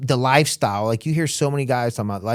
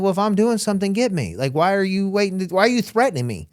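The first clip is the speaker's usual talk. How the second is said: higher defiant challenge; higher mock defiance; 7 semitones higher than usual. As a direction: dare him to act. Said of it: "I'm like".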